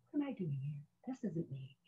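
very soft, almost to yourself; small inner dismay. Retold or imitated. New recruit doubting herself in the cattle car, small and hushed. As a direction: shrink it to a tiny private aside.

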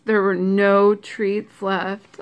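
plain reading voice

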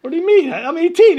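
high, loud indignant whine; mock outraged protest; incredulous protest. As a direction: plead in disbelief. Arguing with bouncer.

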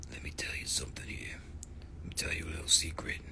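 whispered secret, breathy and hushed. Pretend.

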